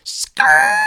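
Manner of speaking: high screeching tire noise. Retold or imitated. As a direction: make the sound of tires peeling out. Imitates car peeling out.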